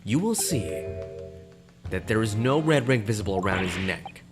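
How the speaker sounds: ordinary talk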